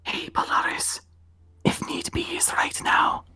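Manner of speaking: soft unvoiced whisper to an ally; [name] whispering to the dragon. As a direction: lean in and breathe it quietly. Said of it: "whispering to the dragon".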